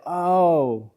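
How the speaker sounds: a gentle, peaceful confession; soft surrender. Retold or imitated. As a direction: let go quietly and sincerely. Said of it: "The vulnerability says".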